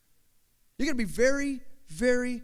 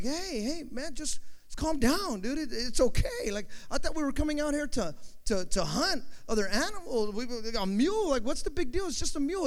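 placating friend; 6 semitones higher than usual. Acted. Friend tries calming the angry man.

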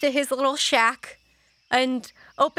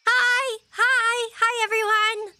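put-on high, sing-out child's hello; greeting in character; 11 semitones higher than usual. Finny greeting everyone, high child voice. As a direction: call it out high and friendly. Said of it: "but they all say hi".

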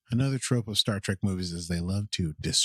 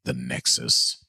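low, ominous announcer-like delivery; deep voice; 3 semitones lower than usual. Acted. Commentator intoning the time ribbon's arrival, deep voice. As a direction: drop right down into a deep voice. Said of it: "I love your deep voice".

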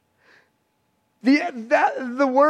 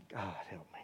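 whisper; 26 dB quieter, faster than usual. Acted.

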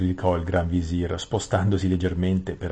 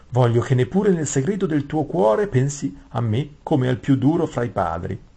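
higher, lilting courtier's voice; wrong character's voice; 7 semitones higher, 6 dB louder than usual. Acted. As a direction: read it pitched up, like a fawning vizier. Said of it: "il re che sta parlando". (voce acuta da cortigiano)

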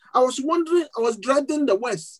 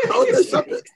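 laughing; 14 semitones higher, 5 dB louder, faster than usual. Real, not acted. Laughing at own punchline.

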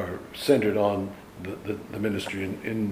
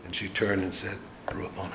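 whispered, softer Rabboni of recognition; hushed reverence; 3 semitones lower, 9 dB quieter than usual. Retold or imitated. Mary recognizing Jesus in the garden, whispered. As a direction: breathe Rabboni in awed recognition. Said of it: "Very, very, very, very, uh, you know, I think, touching".

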